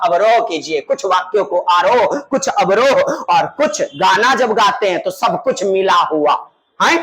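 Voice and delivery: usual speaking voice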